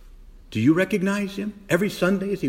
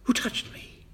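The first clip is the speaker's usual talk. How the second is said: breathy whispered question; whispered, searching question; Jesus asking; 8 semitones higher, 6 dB quieter than usual. Retold or imitated. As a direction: hush it as Jesus wondering. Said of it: "touch his garment".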